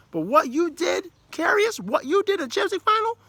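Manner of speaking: high, loud outraged telling-off; loud indignant reproach at the keeper; scolding. Real, not acted. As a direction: call him out like a furious coach. Scolding the goalkeeper directly.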